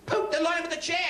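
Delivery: high disbelieving echo; incredulous. Retold or imitated. Job seeker hears the lion instruction, high and incredulous. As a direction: repeat it in total disbelief.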